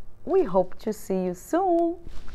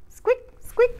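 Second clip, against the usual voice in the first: high squeaky mouse noise; little high squeaks; 12 semitones higher than usual. Acted. Mouse sound in story.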